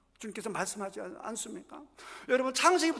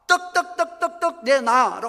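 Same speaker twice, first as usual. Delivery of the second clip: loud, high, insistent repeated demand; mock demanding; 5 semitones higher, 7 dB louder than usual. Preacher imitating a widow pounding on the door, loud demand. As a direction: hammer the word 떡 like a nagging widow.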